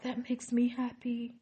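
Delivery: a light hint of [name] being pleased; [name]'s happy reply.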